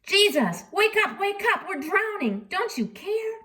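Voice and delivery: high, frantic plea to wake him; hammed-up frightened shout of wake up; panicked disciples. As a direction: cry out in mock panic as the boat fills.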